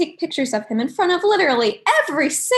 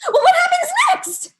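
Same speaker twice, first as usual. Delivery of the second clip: high-pitched loud shout; exaggerated shrieked demand; mock eager listeners; 13 semitones higher, 6 dB louder than usual. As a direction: yell it across as a hooked eavesdropper. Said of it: "the people in the tables nearby are like".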